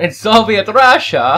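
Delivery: put-on slow, loud Russian accent; mock Russian accent. Mock Soviet Russia joke line.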